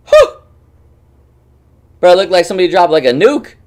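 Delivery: high, loud amazed outburst; excited wow. Real, not acted. Streamer watching a town blow up, loud and amazed. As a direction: blurt it out, stunned by the blast. Reacting to explosion video.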